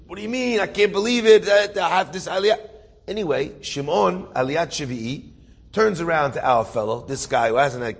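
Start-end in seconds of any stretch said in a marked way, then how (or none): 0.1-2.6 retold incredulous protest, pitched up; mildly frantic, higher-pitched complaint; worked-up disbelief. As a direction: act out a man who can't believe his luck. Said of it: "Shimon's going crazy".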